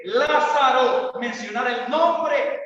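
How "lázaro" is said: "Lázaro" called out higher, louder, slowly stretched; commanding call. Retold or imitated. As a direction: summon one name with authority. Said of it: "Jesús tuvo que decir".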